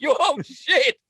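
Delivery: loud, high, laughing shock; mock alarm. Newcomer seeing the locals unmasked, mock alarm. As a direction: yelp it as if horrified. Reacts to villain's reveal.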